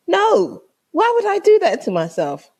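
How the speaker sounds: high, incredulous protest; emphatic refusal. Retold or imitated. Guest refusing to risk voodoo food, emphatic and incredulous.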